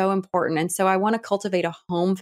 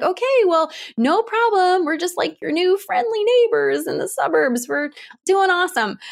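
overly chipper; 10 semitones higher than usual. Retold or imitated. Friendly new neighbours chirping cheerfully at the boy. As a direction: play it relentlessly cheerful. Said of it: "And we're like".

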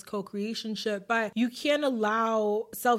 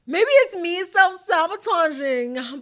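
high, loud, slow teasing aside; mock dramatic aside; 7 semitones higher, 9 dB louder, slower than usual. Real, not acted. Poking fun at herself about posting late, slow and high. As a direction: stretch it out like a guilty confession.

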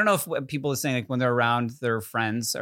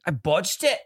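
higher put-on British, rising; lilting British accent, pitched up; British accent attempt; 4 semitones higher than usual. Shown as a demonstration. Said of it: "give our best shot of doing it".

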